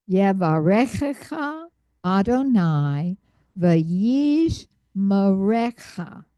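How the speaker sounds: Yevarechecha Adonai v'yishmerecha read out clearly; careful model reading. Shown as a demonstration. Teacher models the full phrase for students. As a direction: model the blessing phrase for the class. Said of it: "look at it as I read it again".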